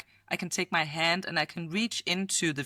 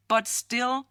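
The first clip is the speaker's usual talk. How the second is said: ordinary delivery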